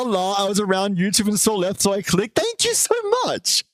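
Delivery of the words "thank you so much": sudden very high squeal of thanks. Real, not acted. Streamer squealing thanks to a new follower.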